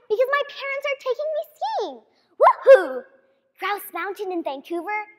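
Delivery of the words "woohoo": loud happy whoop; excited whoop. Retold or imitated. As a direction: cheer like a thrilled eight-year-old. Excited about skiing.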